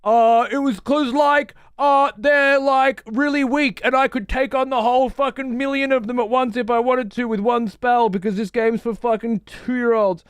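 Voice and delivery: high hammed-up dwarf parody; mock Magni reply. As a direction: make the dwarf sound like a dim brag. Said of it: "he didn't say that".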